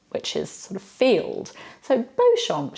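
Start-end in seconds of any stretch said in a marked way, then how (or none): 1.8-2.8 "Beauchamp" said the French way, bo-SHOMP, pitched up; wrong French way. Shown as a demonstration. Teacher gives the wrong spelling-based take. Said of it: "surely it's Beauchamp".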